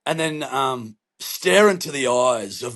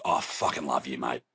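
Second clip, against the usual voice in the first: low, soft, mock-heartfelt declaration; tender, hushed retold reply; heartfelt affection; 12 semitones lower, 8 dB quieter than usual. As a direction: drop down low and quiet, as if deeply moved. His smitten reply to the neighbor.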